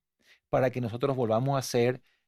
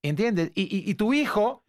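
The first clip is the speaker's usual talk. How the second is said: normal talking voice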